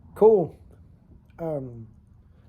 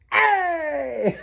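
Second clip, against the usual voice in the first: high, loud laughing hey out the window; bright shouted hello; calling out hey; 11 semitones higher, 3 dB louder than usual. Retold or imitated. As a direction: holler a cheery greeting at a neighbor. Calling out to neighbor while driving.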